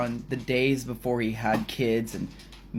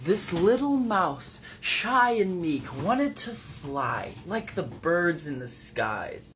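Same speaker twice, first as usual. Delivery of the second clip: fireside fairy-tale narration; 2 semitones higher than usual. Acted. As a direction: spin a tale, stretching key words.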